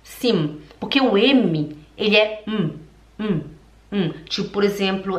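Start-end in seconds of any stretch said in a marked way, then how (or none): 0.0-0.6 put-on German accent. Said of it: "Fale sim".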